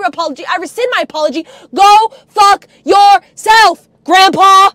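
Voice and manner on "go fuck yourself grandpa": slow, loud, word-by-word shout; furious shout. Real, not acted. Cursing out grandpa in a rant, furious shout. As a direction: shout each word separately, hard.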